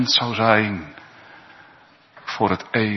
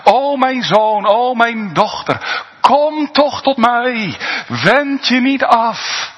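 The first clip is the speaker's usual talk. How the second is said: higher, slow, tender calling; the Lord calling the sinner back with a higher, slow, loving plea; 12 semitones higher, 4 dB louder, slower than usual. Acted. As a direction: plead slowly like a father calling his child home. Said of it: "dat hij je toeroept met de liefde van zijn hart".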